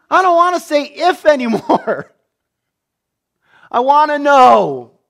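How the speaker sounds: high, loud, laughing through a slowed plea. Retold or imitated. A preacher imagines the father's words, laughing and high.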